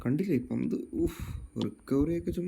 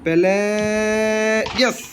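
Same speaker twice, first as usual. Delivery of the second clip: sudden loud high call of the name; excited shout; 9 semitones higher, 5 dB louder, slower than usual. Real, not acted. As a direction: yell the name as the shot goes in. Goal chance, calling the player. (ആവേശത്തോടെ വിളിച്ചുപറയൽ)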